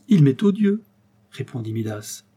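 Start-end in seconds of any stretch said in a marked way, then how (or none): 0.1-0.8 much higher, louder outburst; king rejecting the golden touch; vehement cry. A narrator's reading. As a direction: spit out his disgust.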